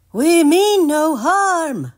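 high, loud, drawn-out call; loud and slow. Acted. Stanley calling out to the scared cow, loud and slow. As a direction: announce peace slowly at full volume. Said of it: "Stanley said loudly".

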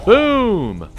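loud, high, drawn-out "boom"; excited shout. Real, not acted. Gamer celebrating a hit in combat, excited shout. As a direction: shout it high and let it fall.

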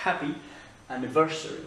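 anniversary stressed on the third syllable, the E, H breathed on happy. Shown as a demonstration. Teacher showing the stress in anniversary. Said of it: "Accent tonique sur le E".

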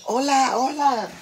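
high, singsong mock hello; high-pitched imitated greeting. Imitates people greeting her.

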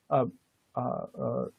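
ordinary talk

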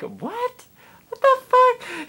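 shocked reply. Retold or imitated. Answering tipsy mom, high shocked disbelief. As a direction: squeak out your disbelief. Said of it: "And I'm like".

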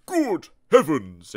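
slow startled doctor voice; surprised doctor. Acted. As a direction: exclaim it slowly, startled. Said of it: "exclaimed Doctor Makey Well".

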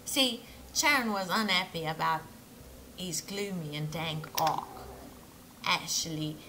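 inconsistent mock British-Australian accent; accent sliding from British to Australian. Shipbuilder recalling a grumbling customer, accent sliding from British to Australian. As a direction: wander from Pommy into Australian. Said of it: "I think I just went from, like, Pommy to Australia".

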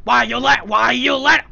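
a mild mock row between two parents; quarrelling game characters. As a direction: ham up a bickering cartoon argument.

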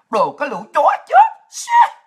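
loud, high angry curse at the trolls; furious outburst. Real, not acted. Streamer cursing hidden online trolls, furious loud outburst. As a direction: spit the insult out, then a shrieked curse.

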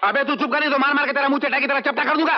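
high, rapid, indignant threat; angry threat. Acted. Master threatens mouthy servant, angry and high. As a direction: shut him up in a high-pitched rush.

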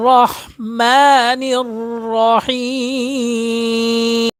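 রহমানির রহিম chanted slowly with a full, heavy র. Shown as a demonstration. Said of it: "উচ্চারণটা হবে কি".

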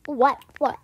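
mock outraged demand; indignant. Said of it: "I'm like".

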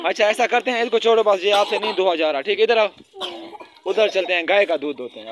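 loud exasperation. Real, not acted. Exasperated, loudly redirecting kids.

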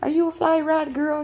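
high, loud cat voice; cat's voice. Acted. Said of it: "And Gucci says".

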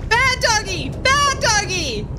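high, slow mock scolding; playful high scolding of a dog. Real, not acted. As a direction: tell off the naughty dog, drawn out. Scolding chasing dog in game.

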